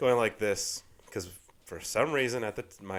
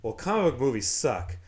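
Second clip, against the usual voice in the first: usual speaking voice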